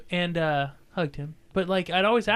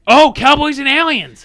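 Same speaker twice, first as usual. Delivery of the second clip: excited; 10 semitones higher, 13 dB louder than usual. Real, not acted. Excited movie announcement.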